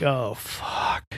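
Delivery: a quiet dismayed curse to himself; muttered curse. Retold or imitated. To himself after the sim news, a quiet curse. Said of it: "I'm like, I'm like".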